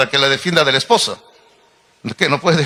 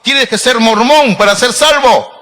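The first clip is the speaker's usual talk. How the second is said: higher and louder mock sermon line; mocking false teacher; 6 semitones higher, 4 dB louder than usual.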